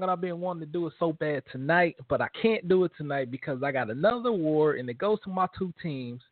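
ordinary talk

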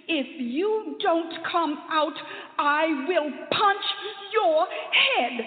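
slow, loud, high mock threat; a little boy's angry threat; child's threat. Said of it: "and I said".

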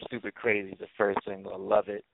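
normal talking voice

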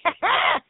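short, high burst of laughter; imitated laugh. God laughing along with the women, imitated chuckle. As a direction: laugh as if in on the joke. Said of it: "He's like".

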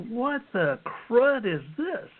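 reliving the shock as energy pours out; astonished exclaim. Retold or imitated. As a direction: stretch it out in disbelief. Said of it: "And I'm like".

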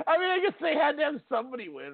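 high voice, talking through laughter; real laughter in the words; laughing. Real, not acted. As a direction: concede it while cracking up.

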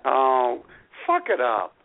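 normal talking voice

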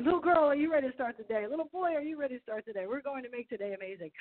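high-pitched pep talk to pets; motivational pep talk. Retold or imitated. Owner giving her two cats a morning motivational speech, high-pitched pep talk. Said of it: "do a motivational speak, speech with my two cats".

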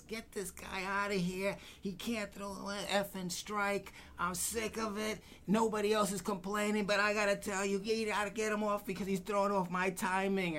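high, riled-up ballplayer complaint; imitating Dempsey. Catcher griping to the manager, high and riled up. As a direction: whine it high like an annoyed catcher.

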